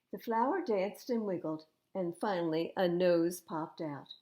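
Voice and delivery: usual speaking voice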